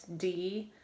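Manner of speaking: "D" with a long final vowel; long end vowel. Shown as a demonstration. Teacher showing pupils the end vowel, "D" said long. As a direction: draw out the vowel in "D". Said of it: "Vowel at the end is long".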